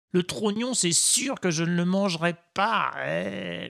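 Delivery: ordinary delivery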